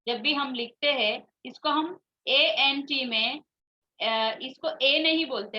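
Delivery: ordinary talk, nothing marked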